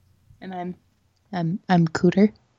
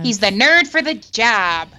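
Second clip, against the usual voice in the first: slow, nerdy Muppet-like governor; mock the governor's Midwestern voice; Rick Snyder. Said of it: "like a terrible Muppet character".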